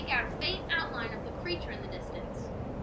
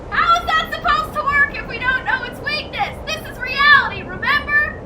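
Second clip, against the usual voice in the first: much higher and far louder yell; furious shrieking; 12 semitones higher, 16 dB louder than usual. Acted. Aubrey screaming at Jim over his silly plan. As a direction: scream your objection at him.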